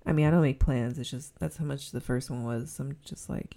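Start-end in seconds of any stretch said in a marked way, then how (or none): 2.8-3.6 hushed aside. Real, not acted.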